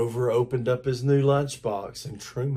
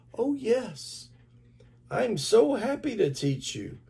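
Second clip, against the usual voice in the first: put-on much higher, bright happy child; delighted; 10 semitones higher than usual. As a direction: burst with joy at being asked. Boy delighted to teach.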